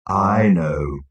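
low, dull, gloomy voice; dull robot. A narrator's reading. Marvin breaking into the argument, low and dull. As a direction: drone it out dully. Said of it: "A low voice echoed dully".

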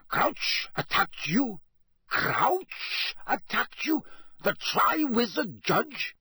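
high, slow, rising disbelief; incredulous. Acted. Karkaroff hearing Crouch attacked his champion, incredulous. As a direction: draw each question out, climbing in pitch.

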